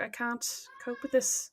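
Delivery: slow, hushed retold despair; quiet, drawn-out mock helplessness; defeated. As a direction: say it softly, as if about to give up. Retelling crying over a dirty nappy.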